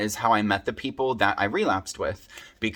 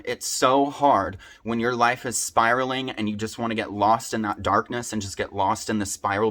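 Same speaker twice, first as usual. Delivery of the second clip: ordinary talk, nothing marked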